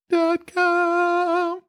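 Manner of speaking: sultry ad voice. Acted. Ad tagline.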